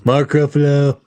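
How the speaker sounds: a hint of Mark Ruffalo's voice; Mark Ruffalo impression. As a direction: lightly mimic Mark Ruffalo. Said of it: "he was the one that first said".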